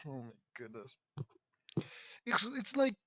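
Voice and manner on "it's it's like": high strained voice giving out; losing voice. Real, not acted. Reader worn out from laughing, losing voice. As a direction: push words out as the voice goes. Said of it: "I think I'm losing my voice a little".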